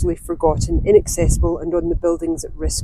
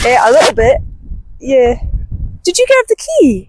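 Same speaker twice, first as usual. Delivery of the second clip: loud raised voice outdoors; shouting over wind; 5 semitones higher, 5 dB louder than usual. Real, not acted. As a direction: call across to someone over wind. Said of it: "I don't even know if you can hear me over the wind".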